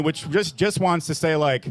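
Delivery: usual speaking voice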